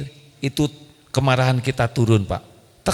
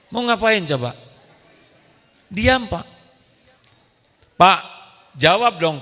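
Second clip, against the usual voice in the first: ordinary talk, nothing marked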